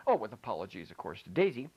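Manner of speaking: ordinary delivery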